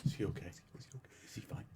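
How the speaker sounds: soft, hushed whispered worry; hushed worried murmur. Retold or imitated. Trainers whispering about the collapsed guy, hushed worry. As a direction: murmur it behind his back. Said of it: "they were all like".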